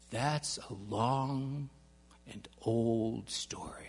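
put-on slow, whispered old man; hushed and slow. A narrator's reading. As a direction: trail off into a whisper, slowly. Mr Jones deflects gently.

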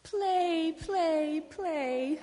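high-pitched eager gosling; excited baby cry. Acted. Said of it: "cried the excited baby goose".

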